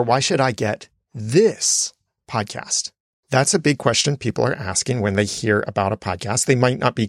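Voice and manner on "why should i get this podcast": "should" stressed and slow. Shown as a demonstration. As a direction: press on the word "should", slow and falling.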